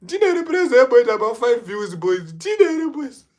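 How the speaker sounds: high and loud hype call; hyped shout-out. Real, not acted. Vlogger shouting out a viewer on live, loud and high. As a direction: call the name out like a hype man.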